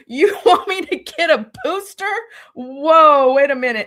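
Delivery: high, loud disbelief with a laugh; incredulous retort. Retold or imitated. Employee replays answering her boss's booster demand, incredulous. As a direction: balk loudly, acting out your shock.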